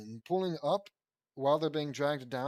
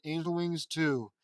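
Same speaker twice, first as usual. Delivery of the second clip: usual speaking voice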